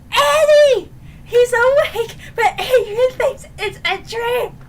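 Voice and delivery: high, loud, giddy squeal; excited reveal. Real, not acted. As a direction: burst out with the big reveal.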